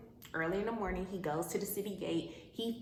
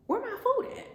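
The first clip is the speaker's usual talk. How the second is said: high, louder indignant cry; outraged question; 5 dB louder than usual. Acted. Naomi at the fridge, indignant high cry. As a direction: demand it in disbelief.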